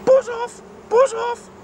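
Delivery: hissed shout. Acted. James yells at the swarming bees in a panic. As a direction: drive the bees away in a panic. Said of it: "hissed James".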